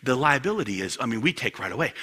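ordinary talk

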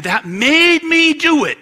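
high, loud blame-shifting wail; defensive outburst. Acted. Excuse-maker blaming someone else, loud and defensive. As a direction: push the excuse up high and drag it out.

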